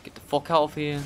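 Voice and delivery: ordinary talk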